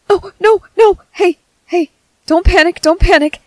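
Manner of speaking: high, loud, flustered soothing; urgent reassurance. Acted. Medic to panicking wounded patient, urgent reassurance. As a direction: hurry to calm them, sounding alarmed yourself.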